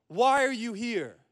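slow, high-pitched holler; yelling centurion. Acted. Centurion demanding answers from a disoriented rabbi, yelling. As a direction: call out the challenge, stretched long. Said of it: "So the centurion again goes".

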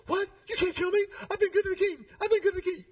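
high, crying panic; freaking out. Retold or imitated. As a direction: whine in a frantic, high sob. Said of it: "flip and go".

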